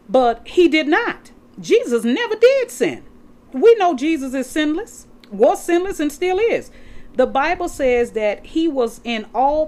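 fervent pulpit proclamation; preaching at full force. Acted. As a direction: rise up and declare it to the congregation.

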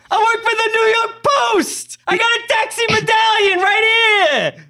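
loud, high mock New York tough-guy accent; Tom Hardy New York accent. Friend mocks Tom Hardy's Venom role in a loud New York accent. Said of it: "and he's like".

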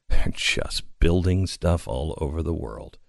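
usual speaking voice

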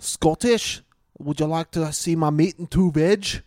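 unconvincing Scottish attempt; Scottish accent. Co-host trying number six again, bad Scottish. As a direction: put on a lilting, badly faked Scottish accent. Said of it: "no, that's wrong".

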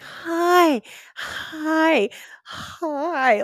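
high, searching doctor-to-patient greeting; warm gooey patient voice. Retold or imitated. Doctor trying out patient voice, high and gooey. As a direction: imitate hunting upward for a gooey bedside tone. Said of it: "gooey patient voice".

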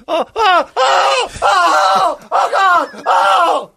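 high panicked screams of a sleeper in terror; night terror screaming. Retold or imitated. Roommate imitating Ray's night terror screams.

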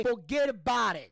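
"forget about it" punched out in a mobster accent; Italian-American with feeling. Shown as a demonstration. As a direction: give "forget about it" soul, falling hard at the end. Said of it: "you gotta say".

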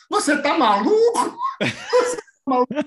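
very high, laughing mock outrage; mock outraged footballer. Retelling the footballer's reply, high laughing disbelief.

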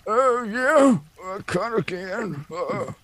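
put-on quavering elderly man; old man voice. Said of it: "Like an old man voice?".

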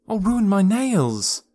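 high, slow drawn-out complaint; whiny wail. A narrator's reading. Alice wails about her nails underground, high and drawn out. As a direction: wail it like a spoiled girl. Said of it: "she cried".

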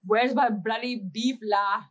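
put-on loud, slow Indian-accented dad; dad's Indian accent. Daughter imitating dad asking for beef curry, Indian accent. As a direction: imitate an Indian dad demanding his dinner. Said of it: "My dad says bloody all the time".

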